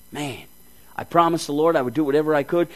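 normal talking voice

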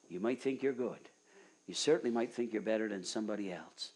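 ordinary talk, nothing marked

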